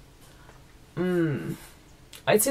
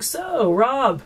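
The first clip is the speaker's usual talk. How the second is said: high, chummy mock of Scott; mock casual husband; 6 semitones higher than usual. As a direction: play the pal on first-name terms. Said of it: "Scott's like".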